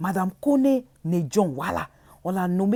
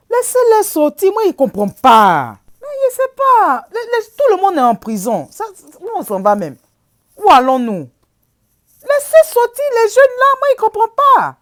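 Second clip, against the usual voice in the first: loud pleading; 10 semitones higher, 7 dB louder than usual. Real, not acted. Pleading to free jailed youth.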